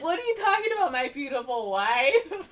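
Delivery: high, hammed-up protest, last words slowed; mock-innocent husband. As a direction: play the baffled husband, half laughing. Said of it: "He's like".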